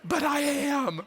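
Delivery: high, exasperated retort, acted out; worked-up mock protest, drawn out and rising high; agitated. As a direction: imitate an agitated dad, pitch climbing on the last words. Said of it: "I was just so agitated".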